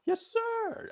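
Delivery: very high, squeaky imitation of the recruit; the English recruit answering the drill instructor, high-pitched; his odd voice. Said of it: "his voice was just that way".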